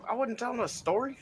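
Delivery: high-pitched objection; high, put-upon complaint; indignant protest. Real, not acted. Protesting being put on the spot.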